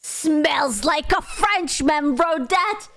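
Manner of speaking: slow, drawn-out disdain; mock snobby sniff. Streamer mocks a commenter with slow snobby disdain. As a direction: sniff at it slowly and smugly. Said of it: "I was like".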